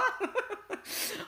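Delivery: high-pitched burst of laughter. Real, not acted. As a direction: laugh at your own joke. Laughs at own joke.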